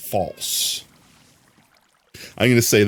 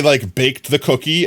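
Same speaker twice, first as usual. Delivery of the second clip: ordinary delivery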